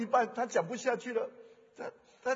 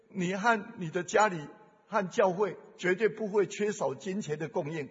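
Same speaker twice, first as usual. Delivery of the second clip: ordinary delivery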